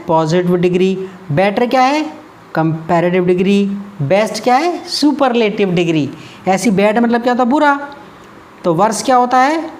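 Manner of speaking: ordinary delivery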